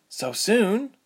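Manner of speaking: SOON lifted into a high question; surprised echo. A narrator's reading. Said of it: "Ilse repeated".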